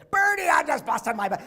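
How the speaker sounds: loud, high, excited cry; thrilled coach exclaiming. Retold or imitated. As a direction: burst out proudly at your grown-up former wrestler. Coach greeting him backstage.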